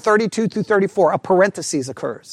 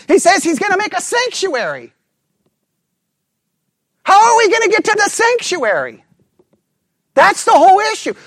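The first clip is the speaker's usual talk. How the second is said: much higher, louder preaching at full force; fired-up preaching; 12 semitones higher, 3 dB louder than usual. Real, not acted. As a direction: build the sermon to a fever pitch. Preacher peaks in excited delivery.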